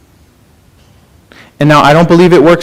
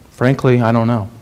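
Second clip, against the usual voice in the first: humble quiet confession; 5 semitones lower, 6 dB quieter than usual. Retold or imitated. Humble criminal answering God.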